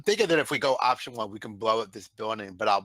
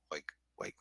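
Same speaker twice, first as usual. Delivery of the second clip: whisper; 3 semitones lower, 12 dB quieter than usual. Real, not acted. Urgent plea for backup.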